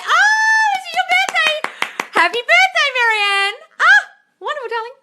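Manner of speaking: high, loud delighted shriek; over-the-top excited well-wishing; gushing birthday congratulations. Real, not acted. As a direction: squeal happy birthday at full volume. Gushes birthday wishes to a viewer.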